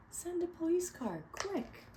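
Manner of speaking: high, urgent order; urgent call. Acted. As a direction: hurry them, it's urgent. Policeman urgently on phone.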